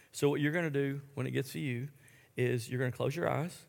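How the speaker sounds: low, softened instructions to one person; quiet aside. Real, not acted. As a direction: take them aside and explain it softly. Aside to last player.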